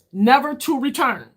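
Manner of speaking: mildly theatrical doom about time; dramatic pronouncement. Acted. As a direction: land it like a movie tagline. Dramatic line on lost time.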